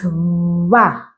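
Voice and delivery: va said slowly with lips buzzing, almost touching; slow buzzy va, lips barely apart. Shown as a demonstration. Teacher demonstrating the buzz, slowly. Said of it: "a bit of a buzzing sound like this".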